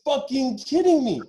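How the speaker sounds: mock-outraged, stretch it out; outraged disbelief. Disbelief at returning mid-flight.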